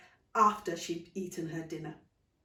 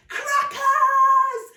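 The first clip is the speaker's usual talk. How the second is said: put-on high, loud children's cry; excited chorus; 14 dB louder than usual. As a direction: shout it out together in delight. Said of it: "the children chorused".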